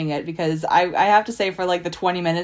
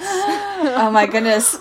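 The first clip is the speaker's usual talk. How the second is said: gasping surprise; 5 semitones higher, 4 dB louder, much slower than usual. Real, not acted. Gasps at secret plans reveal.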